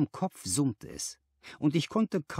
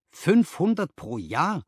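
astonished cry; 6 semitones higher, 5 dB louder, slower than usual. A narrator's reading. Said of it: "rief ich".

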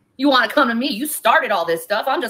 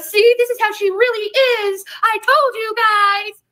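a lightly put-on higher fake voice, the last words drawn out slowly; mocking fake voice; 7 semitones higher than usual. Mocking critics with a fake voice, higher and slowly drawn out. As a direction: sneer it back at the critics in a sugary fake tone. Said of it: "With a little fake, little fake voice on".

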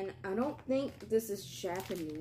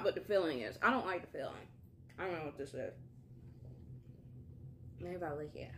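talking while chewing; biting into crisp wafer; mouth full of wafer; faster than usual. Real, not acted. Seen 1: Bites green wafer at 1.8, 3.0, 6.6, 15.0; chews between.

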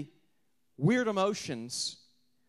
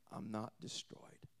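hushed whisper on not destroyed; 8 semitones lower, 15 dB quieter, slower than usual. Acted. Preacher whispering Paul's defiance. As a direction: breathe it out softly.

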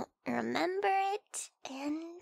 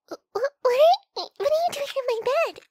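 startled shout; 10 semitones higher, 11 dB louder, faster than usual. Acted. Waking up beside someone, startled.

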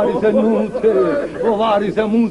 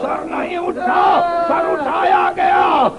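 impassioned majlis lament; 6 semitones higher than usual. A narrator's reading. Preacher recounting the evening of Karbala to mourners, impassioned lament. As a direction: cry out each phrase of the tragedy.

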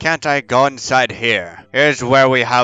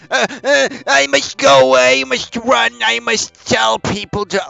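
mock panic; 11 semitones higher than usual. Voicing a panicked game figure.

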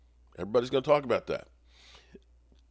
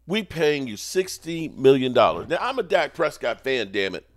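raised, loud exasperated complaint; indignant fan rant; 5 semitones higher, 6 dB louder than usual. Real, not acted. Fan berating his highly paid quarterback, indignant. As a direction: demand better for all that money.

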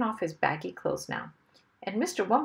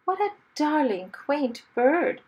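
princess voice; 7 semitones higher, 4 dB louder than usual. Acted. Princess admiring parrot.

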